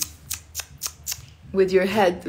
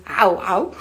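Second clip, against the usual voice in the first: playful imitation of an Arabic dog's bark; mock dog bark; 4 dB louder than usual. Shown as a demonstration. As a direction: bark it twice, "ow, ow". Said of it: "the dog in Arabic doesn't go woof".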